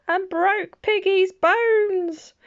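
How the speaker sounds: high, slow mock-shock; milk the twist for the child; dramatic rhyme climax.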